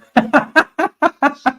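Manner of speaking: sudden high giggle; real high laugh. Real, not acted. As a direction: burst of high-pitched laughter. Reacts to viewer's colonoscopy comment.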